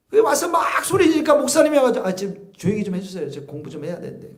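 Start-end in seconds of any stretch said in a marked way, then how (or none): 2.1-4.3 soft, quick shushing aside; lightly voiced annoyed pastor; mock hushing pastor. Mocking a pastor hushing people. (조용히 하라는 목사님 흉내)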